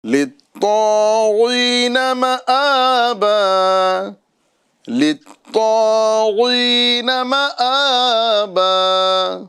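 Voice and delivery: slow, melodic tajweed chant; Quranic recitation. Acted. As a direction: recite it measured and drawn out.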